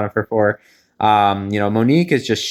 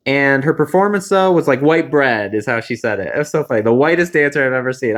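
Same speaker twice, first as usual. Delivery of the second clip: ordinary talk, nothing marked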